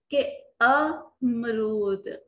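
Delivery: अमरूद very slow with a short अ at the start. Shown as a demonstration. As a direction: say अमरूद slowly with a crisp short अ. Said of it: "आमरूद नहीं". (अमरूद, छोटा अ, बहुत धीरे)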